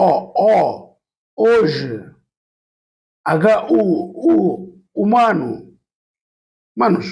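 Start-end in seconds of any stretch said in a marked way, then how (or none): 5.0-5.5 উমানু said as "umanu" with no h; silent h. Shown as a demonstration. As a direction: start উমানু right on the u sound. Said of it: "শুধুমাত্র উ এর উচ্চারণটা". (হ ছাড়া উমানু)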